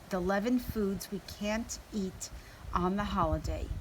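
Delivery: plain reading voice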